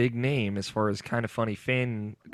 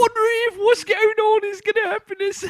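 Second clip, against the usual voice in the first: absurdly high, louder questioning voice; weirdly high-pitched; 6 dB louder than usual. Acted. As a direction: exaggerate the squeaky, everything's-a-question mockery. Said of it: "really weirdly high pitched, and you're talking like everything's a question".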